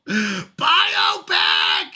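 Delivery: hammed-up yell of "Biopic"; playful full-voiced outburst; mock outraged shout. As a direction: holler it in mock outrage, drawn out. Mock outburst at disputed word.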